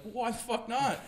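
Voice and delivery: high-pitched retold protest; indignant retort. Retold indignant reply to professors.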